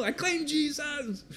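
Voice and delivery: high, slow hammed-up declaration; mock yell, high and slow. Pastor retelling his cry to the gang in the alley, hammed-up. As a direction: ham up the bold cry. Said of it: "Yelling it out in this alley".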